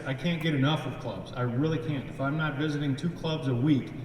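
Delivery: usual speaking voice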